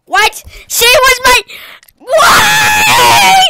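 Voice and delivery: high, loud wail of protest; outraged scream. Real, not acted. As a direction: build from shocked cry to drawn-out scream. Killed by target again.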